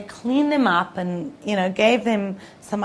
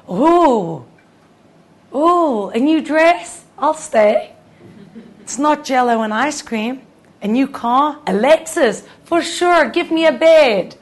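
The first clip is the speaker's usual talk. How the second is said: much higher, louder, gushing; greedy delight; 6 semitones higher, 11 dB louder than usual. Pretend. All of us tempted by gifts, high loud gushing delight. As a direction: coo over each new treat.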